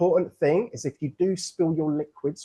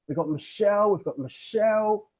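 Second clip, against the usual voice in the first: normal talking voice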